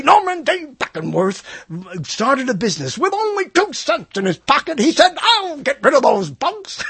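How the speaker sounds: high, overwrought storytelling; hammed-up sob story. Host reading the company founder's life story, mock melodrama. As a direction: declaim a tear-jerking biography, voice pushed way up.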